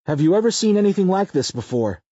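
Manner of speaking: stunned question. A narrator's reading. Sam asks in the meth lab.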